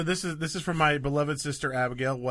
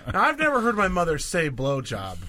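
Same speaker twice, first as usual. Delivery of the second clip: normal talking voice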